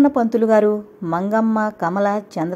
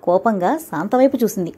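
ordinary delivery

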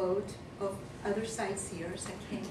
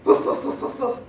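dog barks; 3 semitones higher, 10 dB louder than usual. Retold or imitated. Dogs barking in story.

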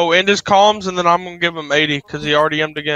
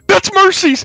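excited; 6 dB louder, slower than usual. Real, not acted. Recognizes famous streamer, thrilled.

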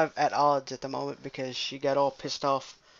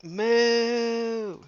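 high mock moo; cow moo; 7 semitones higher than usual. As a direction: moo like the friend. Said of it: "And she'd be like".

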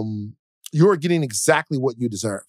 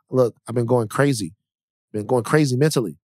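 usual speaking voice

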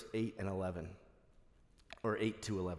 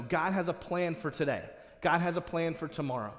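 preaching exhortation; 3 semitones higher, 3 dB louder than usual. Acted. Preacher exhorting the congregation, insistent. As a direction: drive the point home.

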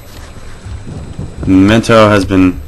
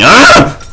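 startled yelp; 6 semitones higher, 4 dB louder than usual. Real, not acted. Startled by the game.

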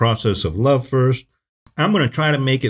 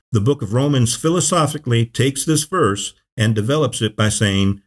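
normal talking voice

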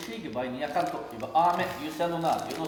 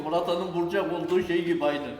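ordinary talk

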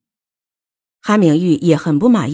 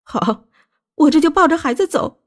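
high-pitched indignant challenge; worked-up wife, voice climbing higher; agitated; 6 semitones higher than usual. A narrator's reading. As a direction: confront him in a rising, upset voice. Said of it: "穆小薇激动地对林子玉说".

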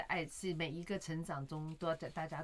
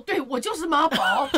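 loud, high, slow and emphatic; proud mock declaration; 7 semitones higher, 11 dB louder than usual. Son answering father's teasing, loudly defiant. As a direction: imitate a son proudly owning it.

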